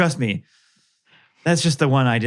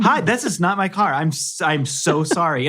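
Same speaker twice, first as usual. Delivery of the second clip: flustered apology; 7 semitones higher than usual. Retold or imitated. Apologizing to stranger.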